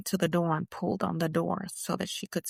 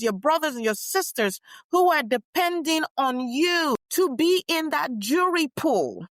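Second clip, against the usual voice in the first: raised, rousing public address; stirring rally speech, higher and louder; impassioned speech; 9 semitones higher, 6 dB louder than usual. Acted. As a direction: speak out to the crowd with conviction.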